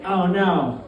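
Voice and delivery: ordinary delivery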